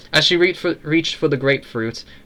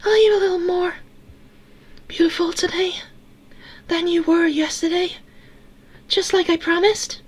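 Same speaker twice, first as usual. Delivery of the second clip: tiny tinny voice, pitched way up; Eleanor girl voice; faster than usual. A narrator's reading. Eleanor asking Sarah about her promise, tinny little girl voice. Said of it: "do the girl voice".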